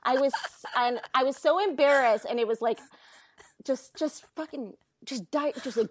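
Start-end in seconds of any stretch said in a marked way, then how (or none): none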